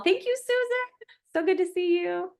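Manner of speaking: high, bright-eyed hello to a friend; delighted high-pitched welcome; excited greeting. Real, not acted. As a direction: lift way up to greet her.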